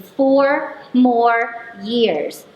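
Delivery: loud, very slow drawn-out cheer; exclaiming. Retold or imitated. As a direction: stretch it out like a celebration chant.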